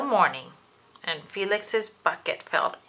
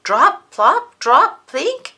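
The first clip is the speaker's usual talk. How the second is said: high, loud plop-plink; cheerful drop sounds; 8 semitones higher, 12 dB louder, slower than usual. Acted. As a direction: let each drop land happily. Drops filling the bucket.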